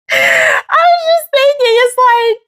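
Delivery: high, squeaky laughing voice; talking through laughter. Real, not acted. Recovering from a laughing fit, high squeaky giggly speech. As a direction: squeeze the words out mid-giggle. Still cracking up from the stumble.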